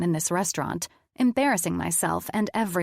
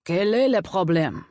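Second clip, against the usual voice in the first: French chef storming in; irate French chef; 4 dB louder than usual. A narrator's reading. Head chef storming into the dining room, irate. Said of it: "the head chef said, storming".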